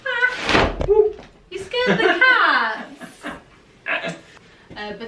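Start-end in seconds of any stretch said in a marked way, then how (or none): 0.0-0.8 sudden high yelp of fright; startled scream. Real, not acted. Reflector pops open, startles. 1.5-3.3 high, loud, slow giggling words; laughing. Real, not acted. Laughing after the scream.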